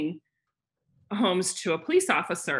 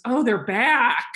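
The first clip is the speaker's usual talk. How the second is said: drawn-out, higher and louder; agent's mock reaction; 5 semitones higher, 6 dB louder, slower than usual. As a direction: imitate the agent, stretching it out. Agent's reaction to squatters, retold.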